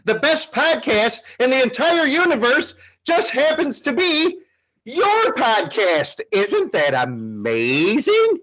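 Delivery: nerdy hero announcing himself proudly; wrestling promo. Acted. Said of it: "cut a promo for, like, thirty seconds in character".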